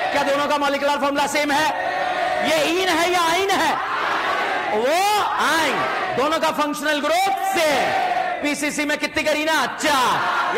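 high, chanted question-and-answer teaching; classroom drill call. Real, not acted. Teacher quizzing students on formula and chain, in a high chanting call. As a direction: pitch questions up, draw out the answers.

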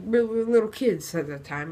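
ordinary talk

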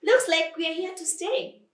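high, loud crowing; gloating crocodile. Acted. Crocodile to the defeated lion, gloating. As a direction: crow over the victory.

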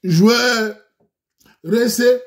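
loud, high hype announcement; brash street-host intro call; hyped intro shout. Acted. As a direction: shout the title like a show opener.

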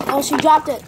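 ordinary talk

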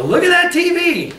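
high, bright show-off voice; mock proud host; proud boast. Showing off TV to visitors.

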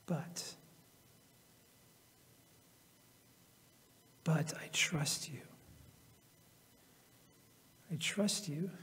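whisper. Acted. Quiet turn to trust.

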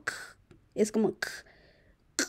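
k popped alone, without a vowel; short bare k, repeated after pauses; accented k sound. Shown as a demonstration. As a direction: demonstrate k as an isolated click-like burst. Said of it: "también tiene un acento".

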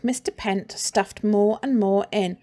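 usual speaking voice

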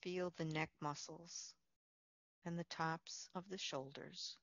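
soft, unhurried meditation voice with long pauses; guided meditation. Real, not acted. Leads a Yoga Nidra relaxation.

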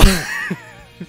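high burst of laughter mid-line; real breaking-up laughter. Real, not acted. Reader fails to finish the rules, helpless laughter. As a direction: crack up before finishing the word.

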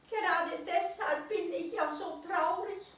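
high, sad little creature voice; sighing little naked mole-rat. A narrator's reading. Sad mole-rat sighs to the owl, high voice. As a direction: sigh it out as the tiny mole-rat. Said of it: "seufzte der Nacktmull".

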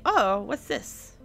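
ordinary talk, nothing marked

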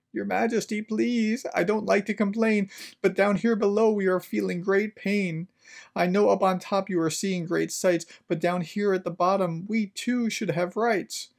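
high-pitched, pleading little turtle voice; small suffering underling, voiced high; groaning plea. A narrator's reading. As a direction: plead upward to the king from under a heavy load. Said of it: "groaned Mac".

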